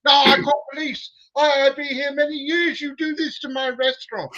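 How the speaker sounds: high, loud, mock accented chef voice, near tears; upset chef. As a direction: plead and threaten at once, pitched far up. Chef threatens to call police.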